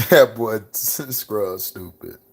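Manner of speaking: amused, words broken up by laughter; laughing. Real, not acted. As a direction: laugh your way slowly through it. Amused reaction, laughing between words.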